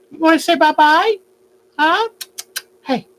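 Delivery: high, quick sing-song; chirpy coaxing for the bird; baby talk to a bird. Acted.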